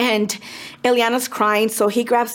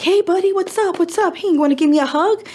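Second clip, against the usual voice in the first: put-on high, friendly coaxing to a kid; gentle uncle greeting; 8 semitones higher than usual. Uncle calms upset nephew, lightly voiced and coaxing. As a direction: coax the boy into a hug. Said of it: "he's like".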